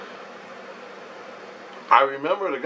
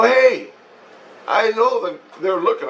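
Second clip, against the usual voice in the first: calling out; 10 semitones higher than usual. Retold or imitated. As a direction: call out to get their attention. Calling out to others.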